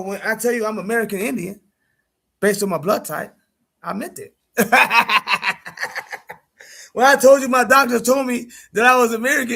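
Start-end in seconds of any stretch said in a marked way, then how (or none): 4.6-6.3 a burst of real laughter; loud laugh. Real, not acted. Laughing at his own story.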